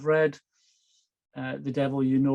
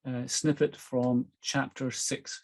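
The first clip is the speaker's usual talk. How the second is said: ordinary delivery, nothing marked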